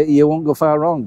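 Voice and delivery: ordinary talk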